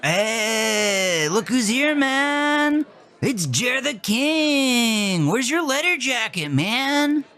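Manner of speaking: a dopey, glassy-eyed stoner greeting the quarterback; stoned party guy. Acted. Stoned classmate voiced.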